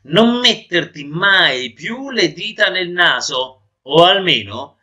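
high, loud, disgusted telling-off; disgusted scolding. Acted. Nose lectures its owner on nose-picking, disgusted and high. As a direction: scold in disgust, drawing the words out. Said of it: "arricciandosi tutto per il disgusto".

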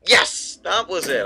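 loud, high cheer of success; triumphant. Real, not acted. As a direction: celebrate the solved puzzle. Puzzle solved.

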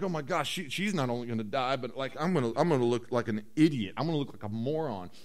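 low, hushed worrying to oneself; mock fretting. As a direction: drop down and fret under your voice. Acts Joseph privately fretting.

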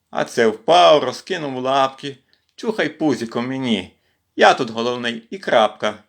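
put-on measured, lilting children's verse; poem recitation. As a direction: recite a playful kitten poem line by line.

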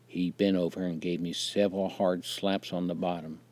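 usual speaking voice

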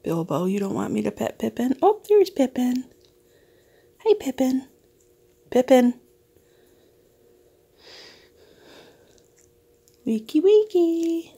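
high, cooing pet voice; pet talk. Real, not acted. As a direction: coo to the pets. Talking to the pets.

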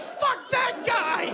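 high, slow, gleeful yell; mock gleeful shout. Comic imagining a patient's death, gleeful shout. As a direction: cheer a rival's death with fake joy.

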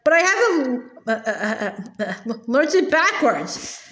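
acted stammer of a flustered schoolboy. A narrator's reading. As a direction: stumble over broken syllables before getting it out. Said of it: "stammered Wilfred".

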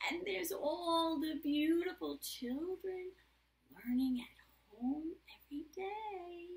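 slow question, then a high sing-song answer; reading to children. A narrator's reading. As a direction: read it brightly and drawn out for kids. Reads picture book aloud.

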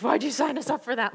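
husband complaining about the group signup; mock angry complaint. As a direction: act out his grumble in a half-hearted way. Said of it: "he got mad".

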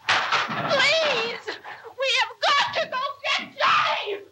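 hysterical begging for help; desperate pleading. Real, not acted. Begging to go get Johnny.

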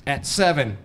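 hammed-up military yelling order; riot yell. A narrator's reading. Friend calls ordering him out, yelling. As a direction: bark it like a riot commander. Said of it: "he yells in his Section eight Merman riot voice".

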